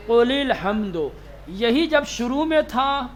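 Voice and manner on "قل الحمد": قل الحمد joined as "qulil-hamd"; hamza dropped. Shown as a demonstration. Teacher repeating for students how قل الحمد is read, linked as "qulil-hamd". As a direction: skip the "a" of الحمد and glide from قل. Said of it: "کیسے پڑھیں گے؟".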